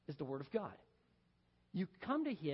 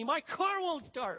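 loud, high, drawn-out mock yell; imitated yell; 7 semitones higher, 5 dB louder, slower than usual. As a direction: yell it like a flustered stranded driver. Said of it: "she yells at me".

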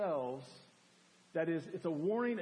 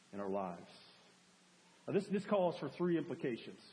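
ordinary delivery

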